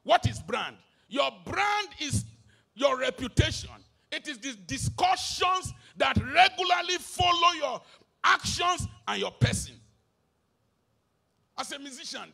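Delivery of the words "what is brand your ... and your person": loud, sing-song preaching, rising on each virtue; full-force preaching. Acted. Pastor preaching character to his congregation, loud and sing-song.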